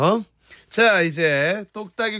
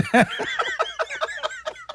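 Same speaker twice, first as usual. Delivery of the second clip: very high, long laugh. Real, not acted. Host teasing guest not to ride along, high laugh.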